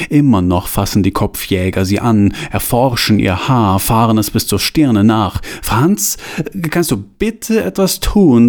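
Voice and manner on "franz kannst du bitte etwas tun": high, slow, forced-friendly plea; strained friendly plea. A narrator's reading. Johanna asks Franz for help, forced friendly and high. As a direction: ask sweetly while the throat hurts. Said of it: "sagt Johanna freundlich".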